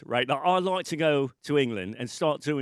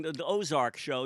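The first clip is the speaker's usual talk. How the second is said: ordinary delivery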